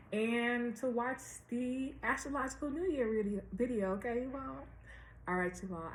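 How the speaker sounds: normal talking voice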